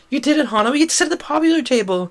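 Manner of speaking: high, rapid, elated squeal of a voice; thrilled friend, loud and high-pitched; excited celebration. Acted. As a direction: gush with triumph, high and fast. Friend celebrates sitting at popular table.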